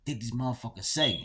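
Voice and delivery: hushed, muttered-under-the-breath disbelief; inner thoughts, quiet. Retold or imitated. Jailed teen thinking to himself, hushed and disbelieving. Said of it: "I'm thinking to myself".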